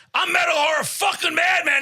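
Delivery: high, boastful shout; screamed self-introduction. Retold or imitated. Contestant grabs the host's mic, screaming his name. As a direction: bellow your name into the mic. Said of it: "And I'm fucking screaming".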